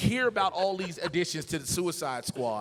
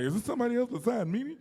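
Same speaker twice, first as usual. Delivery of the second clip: confused listener voice; 8 semitones higher than usual. Retold or imitated. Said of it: "look like".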